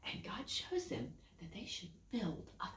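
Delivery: spooky whisper. A narrator's reading. Storyteller building suspense for the children, whispered.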